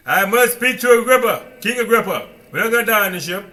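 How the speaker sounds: Paul refusing to fear; bold declaration. Retold or imitated. Paul on the ship insisting to all, loud and firm.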